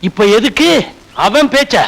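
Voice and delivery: ordinary talk, nothing marked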